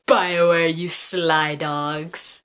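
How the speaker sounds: slow, drawn-out mock reproach; teasing. Vlogger teasing BioWare over the Valentine's date, slow and sly. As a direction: wag a finger at them playfully.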